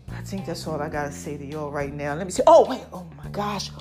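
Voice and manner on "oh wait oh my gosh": normal talking voice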